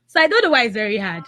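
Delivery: ordinary delivery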